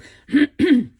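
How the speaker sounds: sharp throat clear from allergies. Real, not acted. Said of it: "my allergies are really playing up today".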